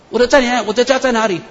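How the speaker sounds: anxious to find her own house; eager asking. Retold or imitated. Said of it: "找他的天使说". (急切地追问天使)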